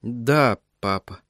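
softly dropping, defeated assent; lost, resigned. A narrator's reading. Son yielding to his father, lost and resigned. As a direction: give in, voice sinking away. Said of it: "сказал он потерянно".

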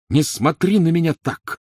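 angry bark. A narrator's reading. Harry, still shaking with anger, barks at Hermione. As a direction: bark it out in a flash of temper. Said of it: "рявкнул он Гермионе".

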